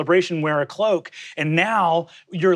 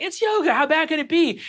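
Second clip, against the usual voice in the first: naive confidence; 11 semitones higher than usual. Retold or imitated. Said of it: "I was like".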